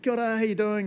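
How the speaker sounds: high, cheery kia ora; bright greeting. Retold or imitated. Barista greeting a grumpy customer, high and cheery. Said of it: "you're like".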